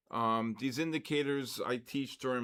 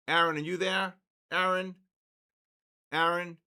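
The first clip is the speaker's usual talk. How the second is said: louder, higher repeated calls; calling for Aaron; 6 semitones higher, 6 dB louder than usual. Real, not acted. Streamer calls his absent moderator, calling out loud. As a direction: call out for someone who isn't answering.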